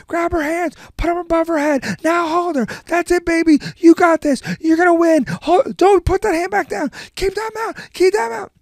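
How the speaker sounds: very high, loud, relentless coaching yell; mock screaming dad. Imitating the dad urging his girl to win, high-pitched screaming. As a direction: imitate a dad frantically cheering and ordering.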